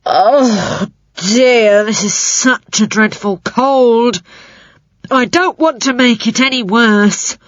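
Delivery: slow, woeful creature voice; sorry spider with a cold. A narrator's reading. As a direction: whine like a sick spider.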